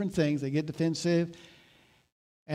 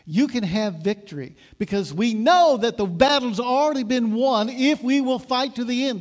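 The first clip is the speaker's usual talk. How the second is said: higher, louder preaching build; preaching at full force; 6 semitones higher, 6 dB louder than usual. Acted. Pastor rallying his congregation to fight on, preaching loud. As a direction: drive the victory home to the congregation.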